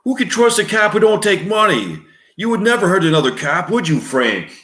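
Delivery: sneering New York cop; New York accent. Acted. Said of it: "male voice, New York accent".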